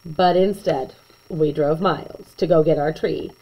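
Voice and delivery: ordinary delivery, nothing marked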